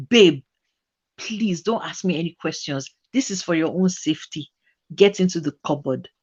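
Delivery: put-on low, soft and urgent as the boyfriend; boyfriend's hushed urging. Boyfriend hiding his girlfriend, low and urgent.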